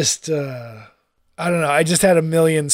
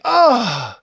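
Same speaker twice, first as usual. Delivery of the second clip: mock Italian accent; 3 semitones higher, 3 dB louder than usual. Quoting the Simpsons waiter greeting Krusty, hammed-up Italian. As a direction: gush over the star like a cartoon Italian host. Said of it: "what was the other one".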